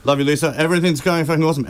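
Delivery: high-pitched run of greetings to absent friends; shout-outs. Real, not acted. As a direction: call out hellos, pitch lifted well up. String of shout-outs to absent people.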